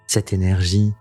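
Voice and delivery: ordinary talk, nothing marked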